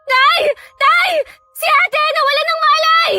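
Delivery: high, tearful screams for help; shrill-pitched, sobbing panic; panicked cries. Acted. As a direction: cry out frantically as if someone just collapsed. Sister faints, calling father for help.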